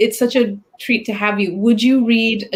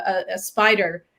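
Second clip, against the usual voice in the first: ordinary delivery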